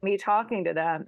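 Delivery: ordinary talk, nothing marked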